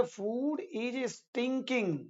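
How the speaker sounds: very slow, spaced-out English; slow classroom demonstration; slow model sentence. Shown as a demonstration. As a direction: spell each word out for learners. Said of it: "हम लोग बोलते हैं".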